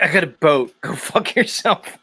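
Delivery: mock brush-off breaking into laughter; Dave Weiss. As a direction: laugh through the imitation of his dismissal. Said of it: "Dave was just like".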